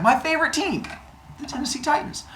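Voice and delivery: high, odd voice for Kronos. Acted. Kronos boasts about his team, high voice. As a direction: give Kronos a strange high voice.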